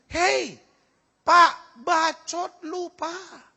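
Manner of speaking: high, slow, drawn-out retort; devil talking back. Acted. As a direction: play a mocking devil answering back. Devil retorts to the blaming husband.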